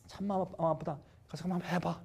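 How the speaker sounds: soft, subdued retold consent; pained consent. God lets the devil test Job, subdued pained consent. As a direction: give leave with a heavy heart, softly. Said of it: "마음 아프지만은 그 수밖에 없어".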